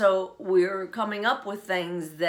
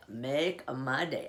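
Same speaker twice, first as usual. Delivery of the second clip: low, slow, softly spoken tough guy; hushed movie-hero catchphrase; Clint Eastwood; 5 semitones lower, 5 dB quieter, slower than usual. Acted. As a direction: take it down low and draw it out. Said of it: "Clint Eastwood one".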